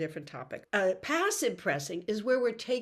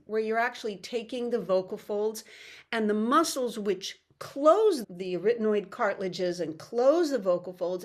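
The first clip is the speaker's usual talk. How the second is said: ordinary talk, nothing marked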